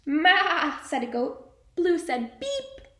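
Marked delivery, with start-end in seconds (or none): 0.1-0.8 high loud goat call; goat bleat. Acted. Teacher reading to class, a loud high goat bleat. Teacher reads animal sounds. 2.4-2.9 high little horn beep; truck beep. Acted. Teacher reading to class, a high little horn.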